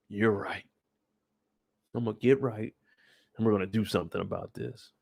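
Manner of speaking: low, soft sheepish reply; a touch of a chastened nephew; meek Clifford. Retold or imitated. Uncle Clifford meekly answers Mom, low and soft.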